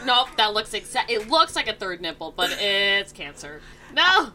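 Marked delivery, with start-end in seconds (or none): 3.9-4.3 shocked cry. Acted. Mock horror at cancer joke.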